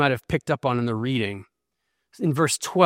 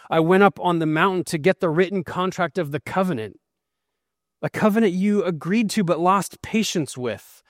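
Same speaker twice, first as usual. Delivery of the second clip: higher, urgent accusing sermon voice; preaching at full force; 4 semitones higher than usual. Acted. Moses confronting stubborn Israel, higher and urgent. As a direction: press the charge on the people.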